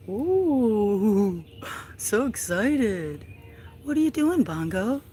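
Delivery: high, loud excited coo. Real, not acted. Owner greeting her excited cat Bongo, high baby talk. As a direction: squeal it at the cat.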